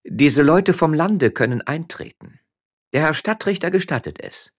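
high, loud stately command; commanding lady. Acted. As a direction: grant entry with authority. Lady of house commands servants. (Frau Stadtrichter befiehlt würdevoll den Dienern)

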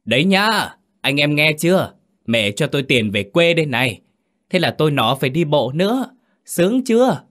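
high, loud joking shout; a loud, high, playful holler; shouting to the hills. A narrator's reading. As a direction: call out far across the hills. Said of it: "hét về phía đồi cao".

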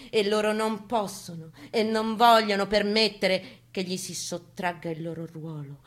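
usual speaking voice

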